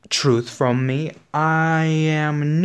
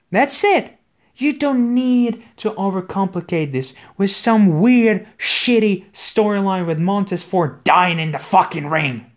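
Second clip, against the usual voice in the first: angry rant; 6 semitones higher, 3 dB louder than usual. Real, not acted. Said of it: "I'm getting angry, like, proper angry".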